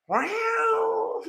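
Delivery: very high drawn-out meow-like wail; high mock cat wail; cat's yowl imitation. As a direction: imitate a cat crying out from afar. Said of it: "you can hear".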